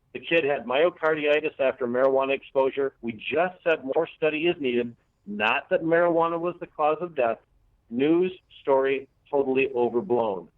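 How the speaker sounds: ordinary talk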